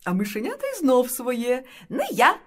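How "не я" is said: high, loud mouse squeak; piping little mouse voice. A narrator's reading. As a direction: squeak out a refusal. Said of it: "пищить круть".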